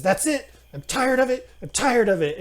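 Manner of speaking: tantrum. Acted. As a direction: explode like a stamping child. Gnome throws tantrum, stamping feet.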